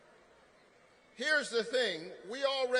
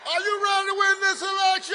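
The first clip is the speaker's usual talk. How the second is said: loud, fast and high, punching win; rally shout; 8 semitones higher, 7 dB louder, faster than usual. Real, not acted. A senator rallies the Georgia crowd with a shout. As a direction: whip up the crowd with a Georgia accent.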